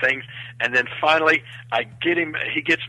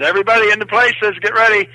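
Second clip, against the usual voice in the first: raised, high call to the set; director calling out; 6 semitones higher, 6 dB louder than usual. Retold or imitated. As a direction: summon everyone to their places. Director calling everyone to places.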